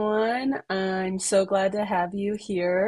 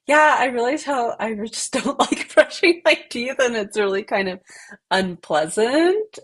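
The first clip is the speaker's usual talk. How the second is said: higher, louder quote with a laugh breaking in; amused mock complaint; 5 semitones higher, 6 dB louder than usual. A teacher retells a student's toothbrushing confession, amused mock complaint.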